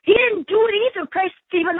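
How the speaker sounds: ordinary talk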